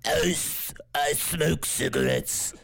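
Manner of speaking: put-on slow, croaky possessed girl; poltergeist voice. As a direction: croak it out slowly. Trying the poltergeist voice.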